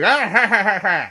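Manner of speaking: villain laugh around a dart; loud laugh through clenched teeth; dart in mouth. Retold or imitated. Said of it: "he'll have a dart in his mouth and just go".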